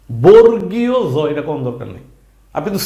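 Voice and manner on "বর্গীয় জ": slow mock spelling. Shown as a demonstration. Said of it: "বর্গীয় জ বলাটারে বাদ দেন।".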